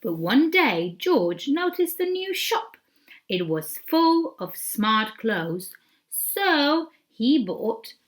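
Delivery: slow, loud, drawn-out storybook voice; story reading to children. Acted. Teacher reading the picture book aloud to children, storybook voice. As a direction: read it out big and slow for little ones.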